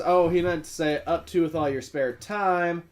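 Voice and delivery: usual speaking voice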